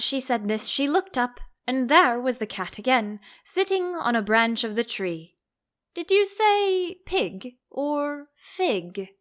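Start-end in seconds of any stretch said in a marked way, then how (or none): 5.9-9.1 Cheshire Cat. A narrator's reading.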